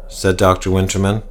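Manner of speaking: ordinary delivery, nothing marked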